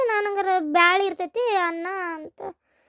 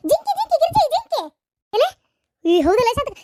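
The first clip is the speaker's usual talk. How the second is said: much higher, louder cry of deer, deer; calling a friend to look, thrilled; squealing out to Girji about the deer; excited calling out; 8 semitones higher, 4 dB louder than usual. Acted. Spotting deer from bus.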